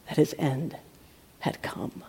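ominous whisper; whispered doom. A narrator's reading. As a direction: breathe it out, hushed. Doom of Haman told.

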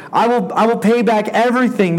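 high, pleading servant voice; begging servant. Acted. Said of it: "and he begged".

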